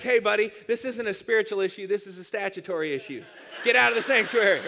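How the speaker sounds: God scolding. Retold or imitated. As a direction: boom out a playful scolding. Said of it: "God's like".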